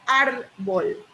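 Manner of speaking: "árbol" in two beats, AR then bol; syllable-split word. Shown as a demonstration. Teacher demonstrating a word to the class, "árbol" split in syllables. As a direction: say "árbol" with a small break after "ár". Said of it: "qué pasa si yo digo".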